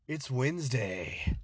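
normal talking voice

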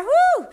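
high, loud whoop of joy; excited woo. Real, not acted. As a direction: let out a happy whoop. Cheer at podcast end.

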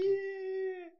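high squealed cheer; a burst of glee; excited whoop. Real, not acted. Gets to tell the story, squeals with joy.

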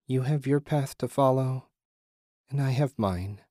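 a hint of a serene ghostly woman; reply as a gentle apparition; female apparition. A narrator's reading.

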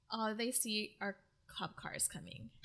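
whispered, building tension in the story; suspense whisper. Real, not acted. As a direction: whisper it like a secret twist. Suspenseful recap of show plot.

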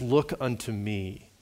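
usual speaking voice

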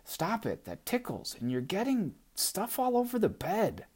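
high, squeaky little doll voice; Gum Baby voice. Acted. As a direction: play a bratty tiny doll.

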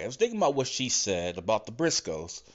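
usual speaking voice

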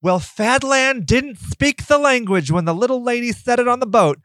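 a high mocking imitation of the critics. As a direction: sneer it out in a silly high voice. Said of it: "because they're like".

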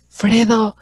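high-pitched calling out across the room; mock yell to get her attention, pitched high; retold shouting. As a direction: friend calling out teasingly, high and insistent. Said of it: "empezó a gritar".

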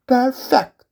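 high bitter lament of the sultan. Acted. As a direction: brood like a betrayed old father.

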